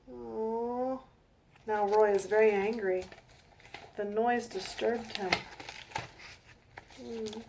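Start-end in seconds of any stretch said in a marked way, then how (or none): none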